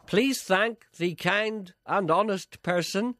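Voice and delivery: high, very slow public appeal; reading out a listener message. Real, not acted. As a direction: read it out deliberately, word by word.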